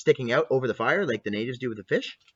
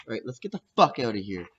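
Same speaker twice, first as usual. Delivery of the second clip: ordinary delivery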